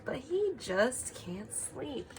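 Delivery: usual speaking voice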